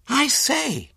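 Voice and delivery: very high, startled exclamation; excited cry. A narrator's reading. Henry startled by the sleeping baby, excited cry. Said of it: "he cried excitedly".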